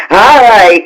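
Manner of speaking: ordinary delivery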